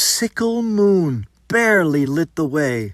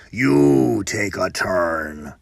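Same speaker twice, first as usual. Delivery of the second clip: huge brute; 12 semitones lower, slower than usual. Acted. Huge man orders, deep voice.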